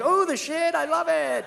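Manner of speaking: high-pitched imitation of a sweet woman gushing; sweet, delighted girlfriend. Kristen thanking them for the present, mock sweetness. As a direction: gush in a put-on high voice. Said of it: "Kristen's so sweet and nice".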